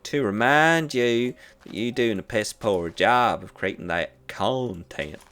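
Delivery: lightly voiced Southern speaker with a mild Southern accent; the speaker mocks YouTube's warning in a Southern accent, hammed-up. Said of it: "I don't know why YouTube has a southern accent".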